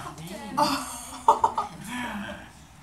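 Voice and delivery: high, put-on flirting laughter; hammed-up coquettish laughing; flirty giggle.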